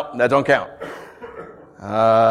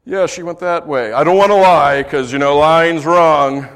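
mock naive honesty; 3 semitones higher, 6 dB louder than usual. Shown as a demonstration.

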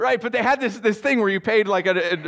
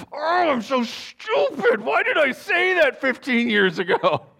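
high, loud, drawn-out wail of regret; self-berating outburst; 12 semitones higher, 3 dB louder, slower than usual. Acted. Driver suddenly remembering an old embarrassment, anguished self-reproach. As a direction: beat yourself up in a hammed-up cry. Cringing at a memory while driving.

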